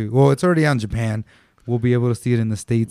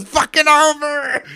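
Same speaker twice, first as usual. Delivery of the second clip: exasperated yell; 3 dB louder, slower than usual. Acted. Mock outrage at episode ending early.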